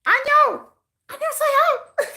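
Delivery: mock Korean viewer, high and sing-song; mock foreign commenter. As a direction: squeak it up like a fan from abroad. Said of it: "It's just".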